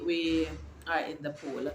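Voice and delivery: ordinary talk, nothing marked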